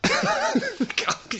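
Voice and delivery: sudden high-pitched laugh; helpless laugh. Real, not acted. Radio host laughing at a tortoise theft, helpless laugh. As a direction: burst out laughing despite yourself. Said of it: "Sorry to laugh".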